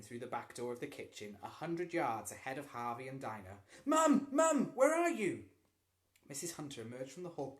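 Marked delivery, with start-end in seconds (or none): 3.9-5.4 loud, much higher shouted call. A narrator's reading. Lloyd bursting into the kitchen calling his mother, loud high shout. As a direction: yell for Mum across the house.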